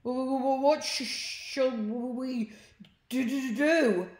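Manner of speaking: high, loud, stuttering fright; hammed-up terrified stutter, drawn out; scared stammer. A narrator's reading. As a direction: stammer every word in panic. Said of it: "the pair were scared".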